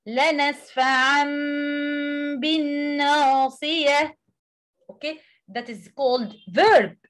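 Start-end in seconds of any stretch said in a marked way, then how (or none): 0.1-4.2 لنسفعا بالناصية recited in tajweed style; Quranic recitation. Shown as a demonstration. Teacher reciting the verse from Al-Alaq as an example, tajweed style. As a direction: read لنسفعا with a clear ʕ and the tanween carried on. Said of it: "verse fifteen".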